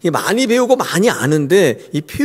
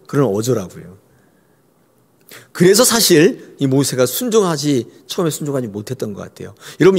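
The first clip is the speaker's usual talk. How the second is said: ordinary talk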